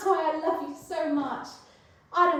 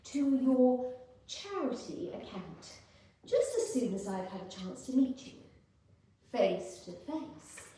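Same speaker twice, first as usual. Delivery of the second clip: sly seductive; 6 semitones lower, 5 dB quieter than usual. Acted. Scheming seductive low voice.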